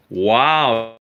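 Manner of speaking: normal talking voice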